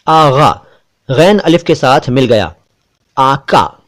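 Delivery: usual speaking voice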